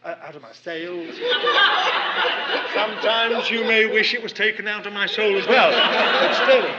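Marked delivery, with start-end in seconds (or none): 1.2-2.7 loud, high burst of laughter; a real belly laugh, much louder; big laugh. Real, not acted. Laughs at his own slip. 2.7-6.7 talking through loud laughter; much louder, laughing the words out. Real, not acted. Joking about his slip of tongue.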